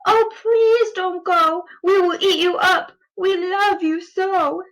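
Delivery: wild things pleading. Acted. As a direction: beg him to stay, sweetly. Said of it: "the wild things cried".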